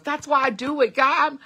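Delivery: high, loud and eager; excited. Real, not acted. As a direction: burst out with real excitement. Excited testimony about her videos.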